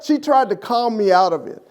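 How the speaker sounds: ordinary talk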